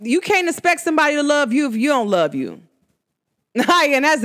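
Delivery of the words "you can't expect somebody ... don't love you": normal talking voice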